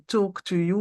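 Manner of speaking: ordinary talk, nothing marked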